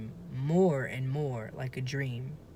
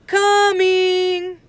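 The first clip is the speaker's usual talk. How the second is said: high, loud shout out the window; shouted reply; 13 dB louder than usual. A narrator's reading. Annie shouting out the window to mom, loud call. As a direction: yell back to mom. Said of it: "and shouted".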